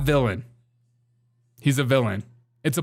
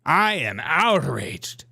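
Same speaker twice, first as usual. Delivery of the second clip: mock outrage; much slower than usual. Mock outrage repeated for effect.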